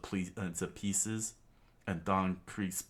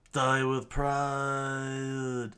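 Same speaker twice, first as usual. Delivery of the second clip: loud, higher, very drawn-out cry; villain's scream; 6 semitones higher, 5 dB louder, much slower than usual. Acted. Don Krieg finishing Luffy off, long shout. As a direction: bellow it, stretching the last word out long. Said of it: "Don Krieg screamed out".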